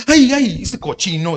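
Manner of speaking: high, loud "ay, ay" dropping into scolding; mock scandalized fright. Preacher mimicking a God scared by blunt prayer, mock scandalized. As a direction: act shocked, hammed-up. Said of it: "cree que a Dios lo asusta eso".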